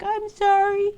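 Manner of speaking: put-on very high, small child voice; apologetic little girl. Little girl told off by Daddy Wolf, apologetic. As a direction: squeak a meek apology. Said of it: "the little girl was like".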